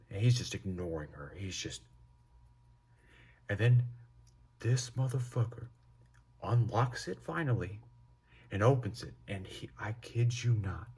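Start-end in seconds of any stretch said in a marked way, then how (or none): none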